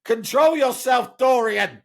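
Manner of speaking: high, loud, slow scolding nurse voice; a mock stern nurse snapping at a boy. Nurse scolding a boy at the check, in a high slow loud voice. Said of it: "heard the nurse saying".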